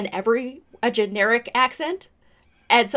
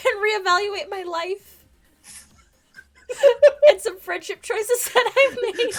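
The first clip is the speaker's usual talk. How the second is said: laughing; 10 semitones higher, 7 dB louder than usual. Real, not acted. Trying to compose self after laughing.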